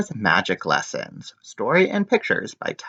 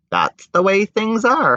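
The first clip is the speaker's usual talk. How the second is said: dismissive father; 11 semitones higher than usual. Acted.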